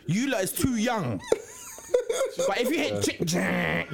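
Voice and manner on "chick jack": imitated click and whirr of dialling; mouthed dial sound effect; rotary dial noise. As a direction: make the sound of an old phone dial. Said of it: "that means man's dialing".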